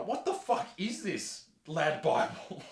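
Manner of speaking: ordinary delivery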